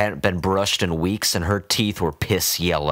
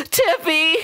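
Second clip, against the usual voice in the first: loud, far higher wail of the name; crying out; 4 dB louder than usual. A narrator's reading. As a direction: cry out as he is taken away. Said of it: "she cried".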